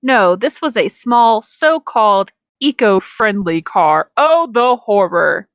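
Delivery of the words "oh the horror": ordinary talk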